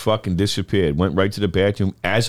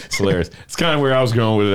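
ordinary delivery

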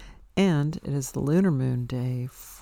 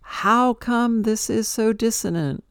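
yelling at the flow; 8 semitones higher, 5 dB louder than usual. Retold or imitated. Yelling at the flow for making things hard, loud and high. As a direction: hurl it upward in frustration. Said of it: "I'm yelling at the flow".

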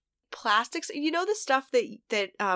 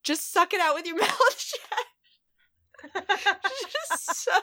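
high-pitched, cracking up mid-sentence; laughing; 11 semitones higher than usual. Real, not acted. Mother admitting her first idea to her co-host, laughing. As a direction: let laughter push the pitch way up.

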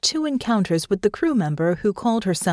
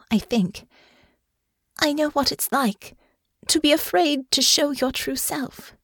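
rueful; 8 semitones higher than usual. A narrator's reading. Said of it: "She let out a rueful chuckle".

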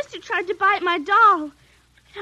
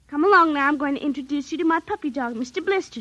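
ordinary talk, nothing marked

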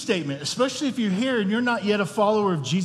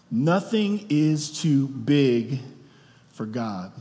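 slow deliberate emphasis; 4 semitones lower, slower than usual. Acted. Preacher repeats the theme.

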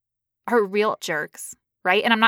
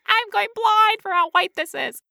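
mock squeal; 10 semitones higher than usual.

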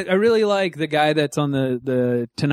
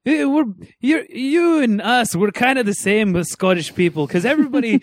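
raised-pitch mock Scottish accent; lively, higher Scottish accent impression; Scottish comedian; 7 semitones higher than usual. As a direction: imitate a Scottish stand-up addressing the crowd. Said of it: "the Scottish guy".

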